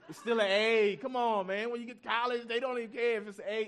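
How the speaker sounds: yelling at son. Retold or imitated. Father yelling at his crying son over a ninety-five, loud and exasperated. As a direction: let the frustration boil over into a yell. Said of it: "I'm yelling at my son".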